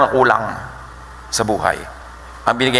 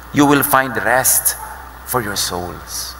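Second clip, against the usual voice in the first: slow gentle; 2 semitones lower, 3 dB quieter, much slower than usual. Real, not acted. Preacher gently quotes Jesus.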